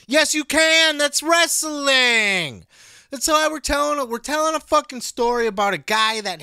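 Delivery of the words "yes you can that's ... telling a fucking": loud rebuttal. Real, not acted. Host snapping back at complaining fans, loud and emphatic. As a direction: hammer the point home.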